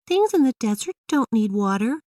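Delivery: put-on high, confident young girl; a kid sure she's right; Emily's voice. A narrator's reading. Emily argues with her dad about deserts, high girlish voice. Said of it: "she said".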